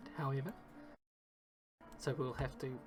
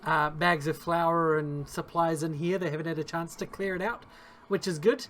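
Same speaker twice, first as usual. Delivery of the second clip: storytelling game master voice; game master narration; 7 dB louder than usual. Acted. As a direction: set the scene like a dungeon master. Game master describes the scene.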